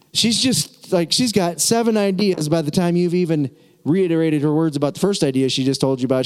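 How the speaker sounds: ordinary talk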